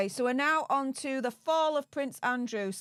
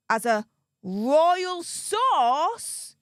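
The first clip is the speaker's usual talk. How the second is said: sarcastic air quotes; 6 semitones higher, 4 dB louder, much slower than usual. Retold or imitated. Sarcastically quoting the phrase.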